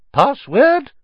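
very high, loud, sing-song demand for the password; fat lady portrait. Acted.

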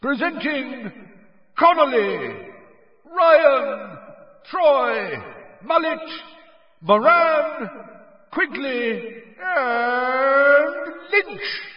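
high, loud, drawn-out announcer call; stadium announcer. A narrator's reading. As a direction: announce the team to the whole stadium. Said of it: "yelled Bagman".

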